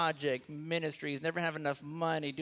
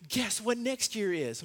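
happy wife; 6 semitones higher than usual. Retold or imitated. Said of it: "she came up super happy and said".

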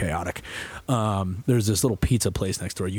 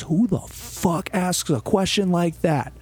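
much higher, slow and accusing; angry friend; 10 semitones higher, slower than usual. Retold or imitated. Friend storming over to confront him, outraged. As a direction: imitate her outraged demand, dragged out.

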